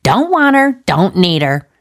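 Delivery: lilting mock sing-song. A narrator's reading. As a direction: sing it out carelessly, dismissive. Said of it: "said the woman in a sing-song voice".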